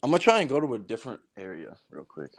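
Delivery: echoey basement room sound; speaking from a hollow basement. Real, not acted. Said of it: "Are you in a basement?".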